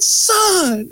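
high crying call of son; retold joyful cry. Speaker retelling the hug to cohost, mock joyful cry. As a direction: call him like a long-lost son. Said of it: "grabbed him and said".

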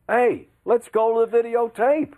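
high, punchy sportscaster catchphrase; exclaimed high, like live TV; excited on-air call. Retold or imitated. Acts out his on-air catchphrase call.

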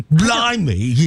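exaggerated British "Blimey" with a rising end; mock British. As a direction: exclaim it like a cartoon Brit. Mimicking the reader's British word.

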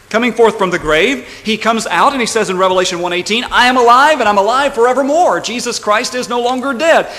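loud, high, triumphant proclamation; preaching at full force. Real, not acted. Preacher proclaiming the resurrection, preaching at full force. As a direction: ring it out from the pulpit without letting up.

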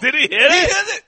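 laughing, loud question; cracking up mid-question. Real, not acted. Mocking the missing call.

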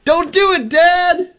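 high falsetto mock yell. Host voices cousin objecting at the wedding, high-pitched mock yell.

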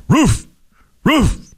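high, barked yelps of roof; dog barking words. Retold or imitated. Talking dog answers its owner in high barks. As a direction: bark each roof like a dog, pitch climbing. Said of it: "Any dog can bark like that".

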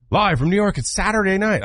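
high-pitched mock show opener; show-opening call. Quoting the show's announced opening line.

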